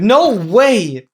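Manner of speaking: real astonished outburst; amazed disbelief. Real, not acted. Surprised at a chatter's news.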